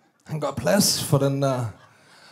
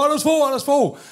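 shouting his name; 9 semitones higher, 3 dB louder than usual. Retold or imitated. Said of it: "Råber".

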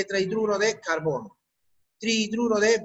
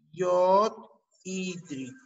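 slow, split into yod and hídrico; slow dictation; 3 semitones lower, much slower than usual. Shown as a demonstration. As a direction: spell out "yodhídrico" by sound, slowly. Dictating new term in parts. (dictado lento, por partes)

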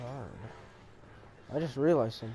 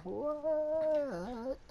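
surprised; 13 semitones higher, 5 dB quieter than usual. Real, not acted. Startled question.

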